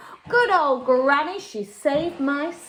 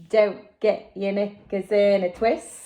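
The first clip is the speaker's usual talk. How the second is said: put-on lower, unbothered boy's voice; calm Jack; 4 semitones lower than usual. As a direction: brush off the panic coolly. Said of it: "said Jack".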